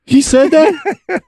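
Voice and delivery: stunned, high disbelieving question; can't believe what he heard; surprised. Real, not acted. Shocked at an outrageous claim.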